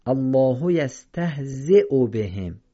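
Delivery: یستهزئ read slowly, ya silent; slow correct recitation. Shown as a demonstration. Teacher reciting slowly for students to copy. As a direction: recite یستهزئ bhm slowly with the hamza clean. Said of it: "خونده نمیشه".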